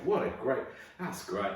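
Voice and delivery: usual speaking voice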